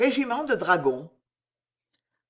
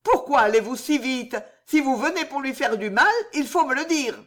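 angered; 7 semitones higher, 4 dB louder than usual. Acted. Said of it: "courroucée".

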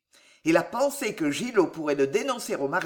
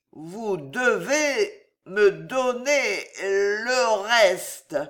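slow, drawn-out slurred demand; drunken halting insistence; drunk Gillot; 3 semitones higher, 3 dB louder, much slower than usual. Acted. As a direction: drag the words out tipsily. Drunk nephew stammers his demand.

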